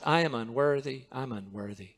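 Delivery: humble confession. Shown as a demonstration. Preacher models the right repentant mindset, low and hushed. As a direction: let it sink to a humble near-whisper. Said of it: "that's not the mindset".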